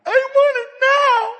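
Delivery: put-on high, loud, slow whining child voice; whiny child. Imitating a spoiled child whining to Father, high and loud. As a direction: whine it like a spoiled kid demanding. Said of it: "rewarded me with whining".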